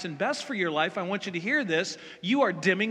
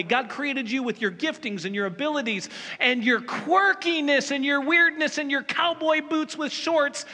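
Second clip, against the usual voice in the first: louder and higher, building into a booming declaration; rising preaching fervor; 5 semitones higher, 3 dB louder than usual. Acted. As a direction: preach it out with mounting force. Preacher voicing God, building up.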